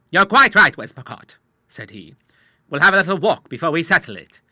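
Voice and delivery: a bluff Admiral holding his ground. A narrator's reading. The Admiral answering the agent, a lightly voiced bluff seaman.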